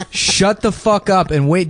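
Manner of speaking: slow, deliberate scolding; domineering. Acted. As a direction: spell it out like to a child. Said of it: "repeats own line, slower".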